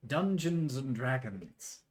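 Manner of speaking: slow, grand broadcast intro; announcer voice. Acted. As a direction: welcome them like a TV host. Broadcast-style show intro.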